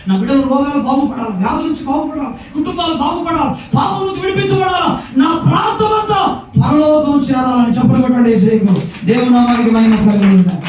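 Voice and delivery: prayer list climbing high, then loud praise; full-force preaching. Real, not acted. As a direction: build each plea up to a shouted acclamation. (ఉద్వేగంగా పెరిగే ప్రార్థన, బిగ్గరగా స్తుతి)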